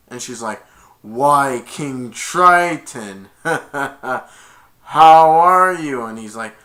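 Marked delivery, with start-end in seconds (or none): 1.0-6.1 loud, drawn-out mock greeting with a laugh; gloating villain. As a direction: relish every word, slow and loud. Villain mockingly greets the king.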